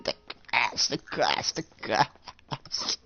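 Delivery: hushed, fading lament; hammed-up grief. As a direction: trail off into a broken mock whimper. Acted collapse, pounding chest.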